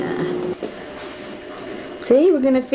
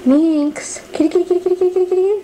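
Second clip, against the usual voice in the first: calling a cat; 7 semitones higher, faster than usual. Real, not acted. Calling the cats to come.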